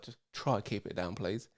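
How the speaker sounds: softly shushing a tipsy friend; hushed plea. Retold or imitated. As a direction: keep it down so the kids sleep. Shushing her near sleeping kids.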